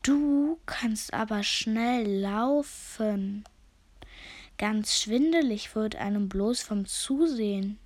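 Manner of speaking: very slow, drawn-out snail voice; a sluggish snail marvelling at the tortoise, very slow; endlessly slow snail. Acted. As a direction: stretch every word like a slow snail. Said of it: "unendlich langsam".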